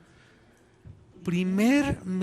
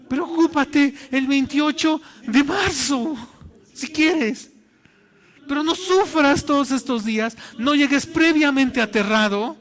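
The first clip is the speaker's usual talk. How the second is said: much higher and louder, slow forceful preaching; preaching at full force; 8 semitones higher, 7 dB louder than usual. Acted. Pastor urging the congregation not to worry early, fervent preaching. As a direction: proclaim it to the back row.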